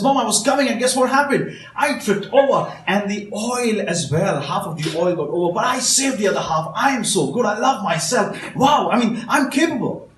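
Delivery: put-on high, loud, thrilled child bragging; bursting with pride, high and loud; excited kid. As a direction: gush like a proud little kid who just saved the day. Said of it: "is very excited".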